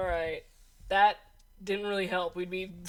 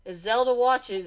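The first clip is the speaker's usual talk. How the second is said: ordinary talk, nothing marked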